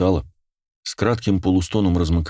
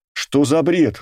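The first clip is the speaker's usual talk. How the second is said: high-pitched, indignant outburst; angry; 9 semitones higher, slower than usual. A narrator's reading. Girl rejecting the impossible news, angry. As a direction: scoff crossly, pitched up. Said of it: "рассердилась девушка".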